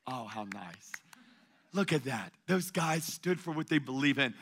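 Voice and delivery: soft, mocking 'how nice'; mock sweet praise. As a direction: coo like polite onlookers.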